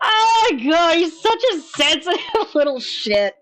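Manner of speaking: laughing. Real, not acted. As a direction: crack up while saying it. Laughing at streamer.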